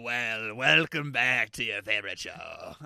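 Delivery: lightly voiced pirate host; a touch of a hammy pirate; half pirate voice. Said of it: "that's like a half pirate kinda guy".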